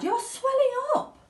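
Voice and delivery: very high frantic cry; horrified mother. Acted. Mother screams as Violet swells. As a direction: shriek in disbelief. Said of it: "screamed Missus Beauregard".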